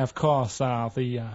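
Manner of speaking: hesitant, barely there American accent. Shown as a demonstration. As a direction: attempt an American accent on request, unconvincingly. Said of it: "Do that American accent again?".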